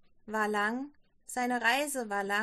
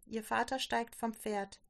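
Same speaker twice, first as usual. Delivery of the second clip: ordinary delivery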